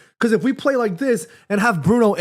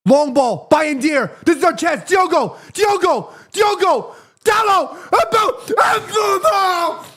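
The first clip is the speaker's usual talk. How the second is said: excited cheering; 10 semitones higher, much slower than usual. Real, not acted. Fan calling players on during a chance, high excited shouts. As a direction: urge the attack on, voice climbing. Fan urging attack live.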